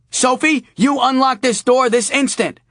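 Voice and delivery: high, loud stern mom calling out; a mother demanding the door open; scolding mother. Acted. Mother yelling at her daughter through a door, scolding voice.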